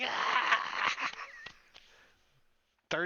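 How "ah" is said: high-pitched burned cry; acted pained scream. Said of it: "She's like".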